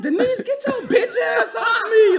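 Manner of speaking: high shouted telling off; loud retold yell. Yelling at a coworker who grabbed him, loud and high. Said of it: "I said this shit loud".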